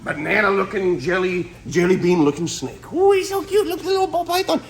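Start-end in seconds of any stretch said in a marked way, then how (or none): 2.9-4.6 much higher, louder cooing delight. Real, not acted. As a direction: gush over the snake like a cute puppy. Gushing over a cute snake.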